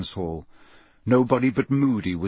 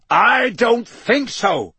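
high, loud, slow roar; 10 semitones higher, 7 dB louder, slower than usual. A narrator's reading. Moody stopping the fleeing ferret, roaring. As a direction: roar it with drawn-out words. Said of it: "roared Moody".

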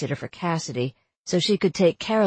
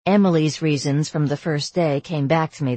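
plain reading voice

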